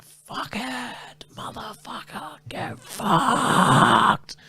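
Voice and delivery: re-enacted meltdown, screeched at the end; raging tantrum. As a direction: start held back, end in a drawn-out scream. Retold tantrum snapping running poles.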